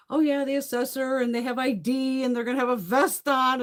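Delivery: singsong-leaning parody of a spiel; rattled-off spiel. Retold or imitated. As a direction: recite it high, mocking the notice. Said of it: "like, the whole fucking spiel".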